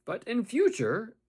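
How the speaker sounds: slow, pointed emphasis on "But in future"; marked stress. A narrator's reading. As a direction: lay deliberate weight on "in future". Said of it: "laid marked stress on the words".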